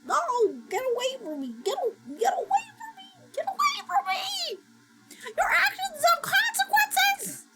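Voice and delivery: very high put-on voice whining at the dog; squeaky victim voice. Streamer scolding the farting dog in a squeaky voice. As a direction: shriek like an offended cartoon.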